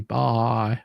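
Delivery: ordinary talk, nothing marked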